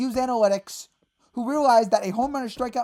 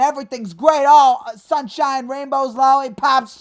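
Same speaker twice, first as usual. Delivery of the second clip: mocking the manager; 3 semitones higher, 7 dB louder than usual. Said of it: "put Aaron Boone and".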